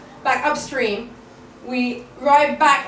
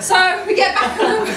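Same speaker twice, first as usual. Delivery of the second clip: ordinary talk, nothing marked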